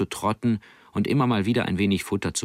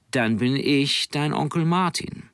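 etwas höher und langsam, ein leicht verstellter Seemann; leicht gespielter Onkel Martin; 7 semitones higher than usual. Acted. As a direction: ein Hauch von fremdem Seemann, langsam. Onkel Martin stellt sich vor. (Onkel Martin stellt sich dem Jungen vor, leicht verstellt)

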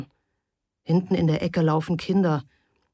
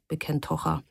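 ordinary delivery, nothing marked

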